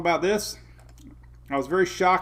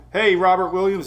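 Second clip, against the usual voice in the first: ordinary delivery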